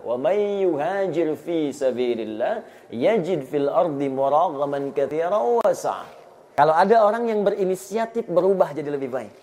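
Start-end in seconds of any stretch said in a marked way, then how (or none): 0.1-6.2 slow, drawn-out chanted recitation of the verse; measured Quranic chant inside a sermon. Acted. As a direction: recite it like tilawah, stretching each vowel.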